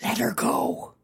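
urgent whispered shush; hushed whisper. Acted. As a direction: hiss it under your breath so she won't hear. Hushing a companion during departure.

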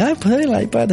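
high-pitched indulgent parent; mock doting parent caving. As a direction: give in fondly, acted out. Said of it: "te pilla ... encariñado con él".

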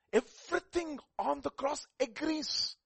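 high, loud and slow, proclaiming; full-force preaching. Real, not acted. Preacher proclaiming to the church, high and loud. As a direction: preach it out to the congregation.

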